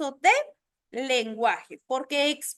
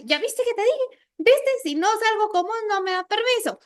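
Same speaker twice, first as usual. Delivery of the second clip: put-on gloating teen; 6 semitones higher than usual. Teen gloats to friend after permission.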